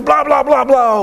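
high, loud mocking babble; mocking blah blah. Preacher mocking Peter's empty boasts, high and loud. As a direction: brush off the bragging with a sneering babble.

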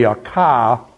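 Boston accent, r dropped from car. Shown as a demonstration. Showing how Bostonians say car, Boston accent. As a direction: drop the r like a Bostonian. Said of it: "you know they're from Boston".

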